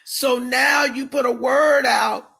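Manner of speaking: ordinary talk, nothing marked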